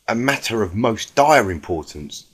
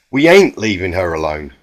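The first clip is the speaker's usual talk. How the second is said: frustrated; 3 semitones lower, 7 dB louder than usual. A narrator's reading. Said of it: "stomped a hoof in frustration".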